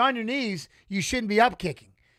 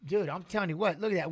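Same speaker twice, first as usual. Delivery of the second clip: ordinary delivery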